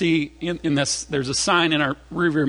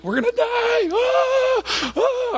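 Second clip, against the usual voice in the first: very high mock shriek; panicking kids; much slower than usual. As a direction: squeal like hysterical children, hammed up. Re-enacts children's earlier panic.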